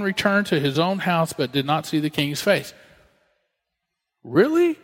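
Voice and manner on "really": mock disbelief. Pastor mocks king's reaction.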